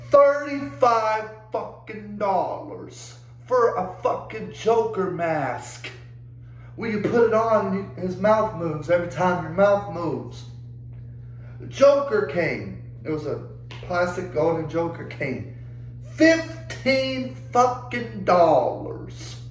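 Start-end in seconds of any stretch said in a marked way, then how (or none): 0.1-5.9 very slow, drawn-out disbelief; slow, incredulous price rant; indignant emphasis. Real, not acted. Outraged at an inflated price. 16.1-19.4 higher and very slow, each word dragged out; outraged, long-stretched words; indignant emphasis. Real, not acted. Outraged at cane's price.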